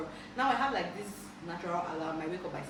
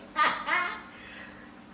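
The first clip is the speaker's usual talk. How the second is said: high airy laugh burst; high breathy laugh; 12 semitones higher, 4 dB louder than usual. Real, not acted. Remembering dad's homework drills, breathy laughing burst. Laugh after mock panic.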